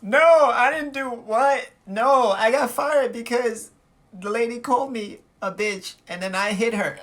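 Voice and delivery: high, drawn-out tearful denial; mock crying. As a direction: imitate a girlfriend wailing her excuses. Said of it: "She immediately starts crying".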